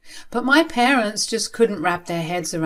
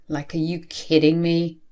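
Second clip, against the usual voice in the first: ordinary delivery, nothing marked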